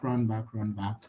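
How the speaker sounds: ordinary delivery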